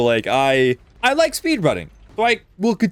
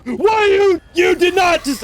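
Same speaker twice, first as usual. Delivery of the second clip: mock indignation; 14 semitones higher, 4 dB louder than usual. Indignant at the monster.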